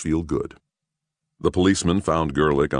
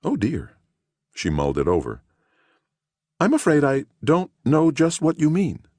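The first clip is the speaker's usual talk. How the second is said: put-on earnest older woman; 11 semitones higher than usual. Woman puzzled.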